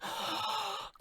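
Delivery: big high mock gasp; shocked gasp. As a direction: suck in air in pretend horror. Racism arrives in the story.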